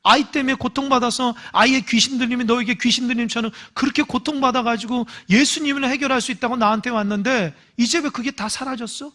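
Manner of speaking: ordinary delivery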